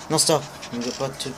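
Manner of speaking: usual speaking voice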